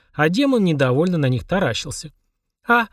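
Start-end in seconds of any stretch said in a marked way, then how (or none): 2.7-2.9 put-on high squeaky little girl; squeak. A narrator's reading. Mashka squeaking at the sight of a demon, squeaky. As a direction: squeak it out, pitched way up. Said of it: "пропищала Машка".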